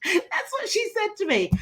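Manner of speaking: high, laughing aside; real laughter. Real, not acted. Laughing at the story.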